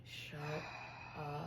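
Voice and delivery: very low-volume mock grumble; soft, groggy retort; imitated sleepy grumble. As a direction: mutter it like a half-asleep husband. Acts out sleepy husband's reply.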